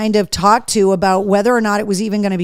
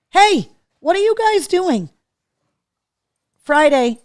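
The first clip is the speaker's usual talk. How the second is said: high-pitched call to the dogs; high, questioning pet-owner call; 7 semitones higher than usual. Real, not acted. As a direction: pitch it up to interrupt misbehaving pets. Calls out to wrestling dogs.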